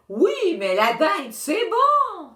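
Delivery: high, slow, loud greedy whine; whining child. A narrator's reading. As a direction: beg for turkey like a spoiled kid.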